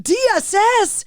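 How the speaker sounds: high, disbelieving echo of the letters; incredulous echo. Retold or imitated. Echoing the question in stunned disbelief. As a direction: repeat it back in total disbelief.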